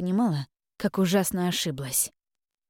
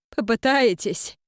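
mocking disbelief; 5 semitones higher, 4 dB louder, slower than usual. Abbess mocks her offer.

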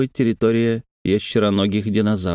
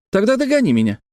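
put-on very high, challenging child voice; taunting little girl; 12 semitones higher, faster than usual. A narrator's reading. Sister dares brother to catch her, high taunting voice. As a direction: dare him in a small girl's voice. Сестра дразнит брата.

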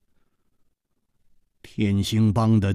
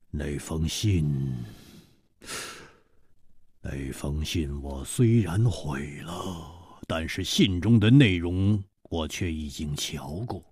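缓缓地说; 9 semitones lower, 7 dB quieter than usual. A narrator's reading. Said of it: "缓缓地说". (缓慢低沉地说)

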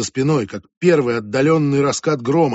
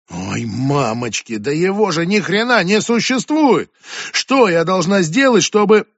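slow groan, then high, loud yelling; fed up and shouting; exasperated outburst; 6 semitones higher, 5 dB louder than usual. Acted. Julia at wits' end.

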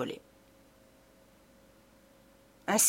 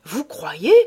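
louder, pressing question in another voice; insistent repeat; 3 semitones lower, 5 dB louder than usual. A narrator's reading. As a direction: push the question back at him. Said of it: "répétait monsieur Filleul".

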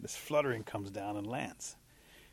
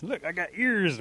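higher, louder insistence; eager little animal; 7 semitones higher, 6 dB louder, slower than usual. Acted. Little animal shows its ears to the others, eager. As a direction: pitch it up and show it off.

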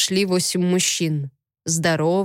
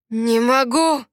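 strained refusal, pitched up; through clenched teeth; 4 semitones higher, slower than usual. A narrator's reading. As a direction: force it out through gritted teeth. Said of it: "выдавил он сквозь сжатые зубы". (выдавить сквозь сжатые зубы)

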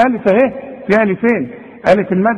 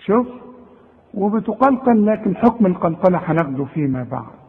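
normal talking voice

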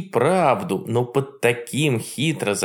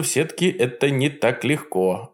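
usual speaking voice

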